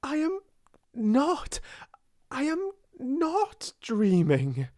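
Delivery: high, halting, emphatic insistence; ecstatic cry. A narrator's reading. Girl convincing herself it is real, ecstatic cry. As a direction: insist breathlessly, word by word. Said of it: "she cried".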